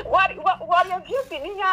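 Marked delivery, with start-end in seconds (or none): none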